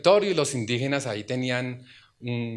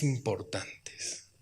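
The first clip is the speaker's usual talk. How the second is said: whisper; 6 semitones lower, 10 dB quieter, much slower than usual. Real, not acted. Emphatic close of a point.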